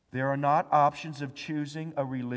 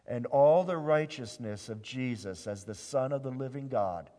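ordinary delivery

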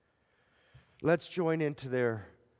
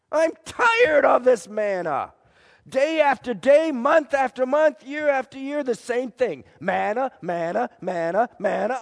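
louder, higher exasperated grumble; fed-up complaint; 7 semitones higher, 7 dB louder than usual. Acted. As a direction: vent the disgust at the same food. Loud grumbling about manna.

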